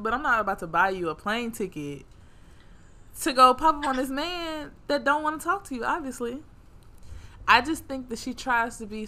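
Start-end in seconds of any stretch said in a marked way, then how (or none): none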